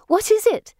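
high, loud excited question; eager demand. A narrator's reading. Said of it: "they demand".

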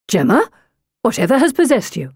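swooping, hurried indignation; shocked brother. A narrator's reading. Brother pulling his sister back into her seat, shocked. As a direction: scold her in disbelief.

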